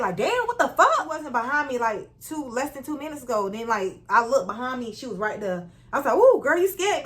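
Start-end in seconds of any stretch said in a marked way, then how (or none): 0.2-1.0 high, loud startled anger; mad from fright. Retold or imitated. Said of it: "you kinda get mad". 6.2-7.0 high, loud retold startle; startled reply. Acts out her startled reply.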